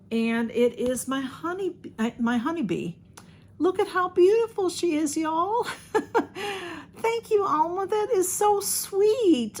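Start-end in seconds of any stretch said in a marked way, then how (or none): 3.6-9.6 touched laugh on the edge of crying; near tears. Real, not acted. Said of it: "Oh, I'm gonna start to cry".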